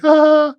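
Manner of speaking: high-pitched fake shrieking laugh; mock girly cackle. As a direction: imitate girls cackling in mockery. Imagined girlfriends laughing at him.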